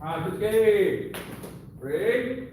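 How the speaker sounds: loud, high drill call; rally the class to get ready; calling out commands. Real, not acted. Sensei starting the drill, loud commanding call.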